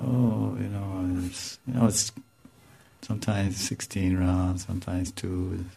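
imitated sheepish answer, soft and hesitant; mock-sheepish, low-key hedging; evasive mumble. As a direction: act out a reluctant admission, trailing along quietly.

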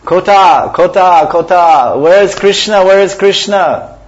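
loud, high anguished calling, slowed at the end; loud high lament for Krishna; crying out. Acted. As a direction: call out for Krishna in longing. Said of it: "The Lord cried out".

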